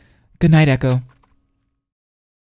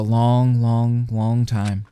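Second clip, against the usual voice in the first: very slow, dragged out; drawn-out; 2 semitones lower, much slower than usual. Acted. A tired character drags out how long she'll sleep. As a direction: stretch each long out sleepily. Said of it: "I want to go to bed".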